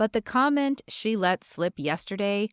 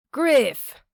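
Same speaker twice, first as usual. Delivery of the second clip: loud Australian greeting, pitched up; booming Australian accent; 6 semitones higher, 7 dB louder than usual. A narrator's reading. Shop owner greets Griff in booming Australian accent. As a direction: call his name out with an Aussie boom. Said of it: "speaking in a booming Australian accent".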